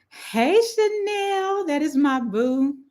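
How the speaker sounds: very high, stretched-out squeal of affection; excited greeting. Real, not acted. Host spots her bestie in the chat, excited greeting. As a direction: squeal the name long and high. Calling out to best friend.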